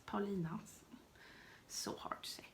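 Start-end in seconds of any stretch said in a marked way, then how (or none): 1.7-2.4 whispered to herself; whispered admission of the struggle; whispered aside. Real, not acted. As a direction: mutter it under the breath. After stumbling on the name.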